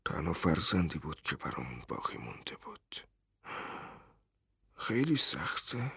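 a broken father telling the stranger his only son is gone; quiet grief. A narrator's reading. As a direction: speak the loss barely above a breath. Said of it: "با لحنی آرام".

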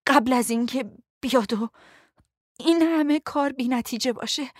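hurting, breathy urgency; strained voice. A narrator's reading. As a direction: push the words out haltingly. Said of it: "صدایش گرفته بود".

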